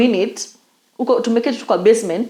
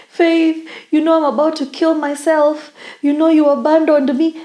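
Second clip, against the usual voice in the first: high, mock-distressed pleading; imitated crying caller; 6 semitones higher than usual. Retelling a desperate old schoolmate's phone call, imitated crying. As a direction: imitate a tearful accusation, pitched up. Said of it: "She's crying".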